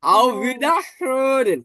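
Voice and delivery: "Wiederhören" said very slowly with a light, thirty-percent r; soft German r. Shown as a demonstration. Teacher drawing out "Wiederhören" slowly for students, soft r. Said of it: "আমি তাও ত্রিশ percent উচ্চারণ করতেছি".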